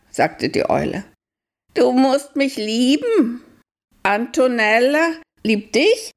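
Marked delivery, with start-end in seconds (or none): 1.7-6.1 eager owl. Acted. Owl talks to Aljoscha. (sehr hohe, langsame Eulenstimme)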